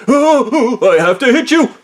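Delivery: high, loud mock outburst; hammed-up overwrought wail; flustered manager. Manager about to hit the worker who hugged him, flustered outburst.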